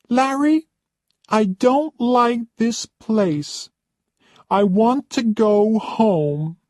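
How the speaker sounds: haughty high-pitched complaining lady; spoilt rich guest. Acted. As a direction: play the pampered diva who expects service. Roxanne complains to Larry.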